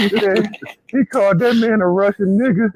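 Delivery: ordinary delivery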